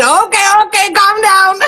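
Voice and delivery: high, squeaky staff imitation; mock nurse voice. Friend imitates the staff calming her, high squeaky. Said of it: "And they said".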